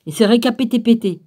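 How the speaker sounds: quick, playful stumble on "récapété-pété", repeating "pété"; deliberately garbled word. Real, not acted. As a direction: say "récapété-pété" with the last syllables doubled. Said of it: "J'en fais exprès de dire ça comme ça".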